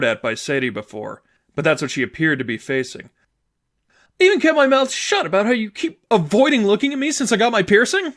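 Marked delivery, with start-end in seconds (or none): 4.2-8.1 high, loud, bitter accusation; glaring girlfriend accusing her partner; resentful girlfriend. Acted. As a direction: throw the grievance at her. Sadie's voice, glaring.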